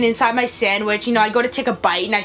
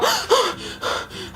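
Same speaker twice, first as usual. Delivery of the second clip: frightened gasps for air; re-enacted breathless gasping; imitated panting; 9 semitones higher, 4 dB quieter than usual. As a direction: pant as if just startled. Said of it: "I start panting and just going crazy".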